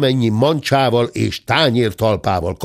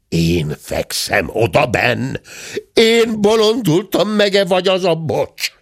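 croaked disbelief; 7 semitones higher than usual. A narrator's reading. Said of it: "hörögte".

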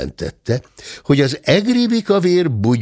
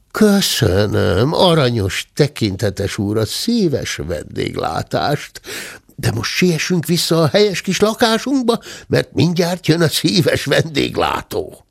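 lightly voiced greedy uncle, mock-courteous and sly; bow and thank the absent host, sly; mock polite thanks; 6 semitones higher than usual. The uncle mockingly thanking the squire before hiding.

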